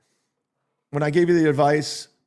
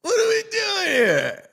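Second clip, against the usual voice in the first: high, drawn-out mock-incredulous Lando; Lando Calrissian impression; 11 semitones higher than usual. Streamer greeting Gino as Lando greets Han, high drawn-out. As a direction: stretch it out like a stunned Lando. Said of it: "my Lando Calrissian impression".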